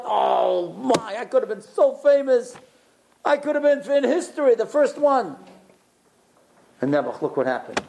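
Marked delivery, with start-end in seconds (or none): 0.0-5.4 jealous wistful voice. Retold or imitated.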